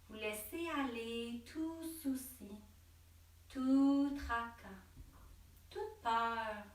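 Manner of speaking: slow, drawn-out meditation voice with long pauses; guided meditation. Real, not acted. Yoga teacher leading listeners to breathe and let worries go, slow meditative delivery.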